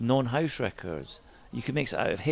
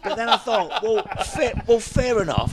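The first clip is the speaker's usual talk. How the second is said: amused; 10 semitones higher, 5 dB louder than usual. Retold or imitated. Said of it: "retold thought".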